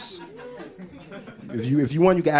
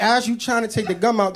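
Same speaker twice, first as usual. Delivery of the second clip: ordinary talk, nothing marked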